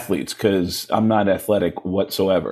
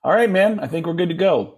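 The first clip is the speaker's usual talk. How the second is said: high, easygoing mock sign-off; lightly voiced director; 8 semitones higher than usual. Director at the board ending a session, lightly imitated. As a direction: lightly imitate a boss wrapping up.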